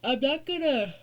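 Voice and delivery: words stretched by a yawn. Real, not acted. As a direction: talk through a big yawn. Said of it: "sorry I keep yawning".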